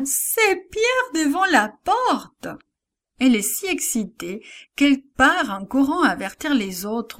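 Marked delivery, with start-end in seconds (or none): 0.1-2.5 high, slow excited exclaim; a girl bursting with happy news; joyful cry. A narrator's reading. Servant girl calling to the praying believers, high joyful cry. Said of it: "crie Rhodes avec joie".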